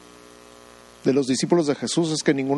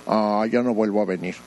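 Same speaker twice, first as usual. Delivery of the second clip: lower, sulking huff; mock sulky vow; 5 semitones lower than usual. Pastor acting out a touchy believer storming off, sulky and low. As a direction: pout it out like someone offended.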